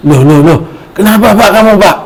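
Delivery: a wife rushing in, shocked; alarmed wife. Acted. Wife finds husband scalded.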